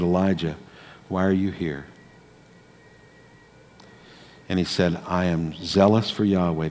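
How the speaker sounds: soft, low, hushed reading; quiet gentle voice; gentle whisper. Acted. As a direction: let it fall to a near hush. Gentle whisper of God scene.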